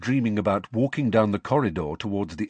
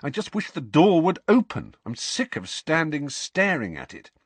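frustrated; 7 semitones higher than usual. A narrator's reading. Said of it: "He rubbed his forehead angrily".

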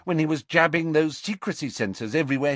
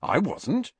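high, mildly startled reply; a touch of surprise, pitched up; taken aback; 10 semitones higher than usual. A narrator's reading. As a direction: caught slightly off guard. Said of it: "taken aback".

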